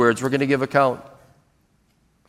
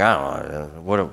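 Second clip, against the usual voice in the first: very soft, low mumble to oneself; muttering; 12 semitones lower, 8 dB quieter than usual. Shown as a demonstration. Worker grumbling to himself while walking away, muttering. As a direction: mutter broken phrases under your breath. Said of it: "that's muttering".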